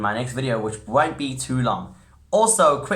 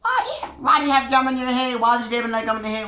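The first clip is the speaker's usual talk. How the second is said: high shrieking parent voice, much higher and louder; retold parents' scream; 12 semitones higher, 4 dB louder than usual. Parents shrieking at their child about gum. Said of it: "they would scream".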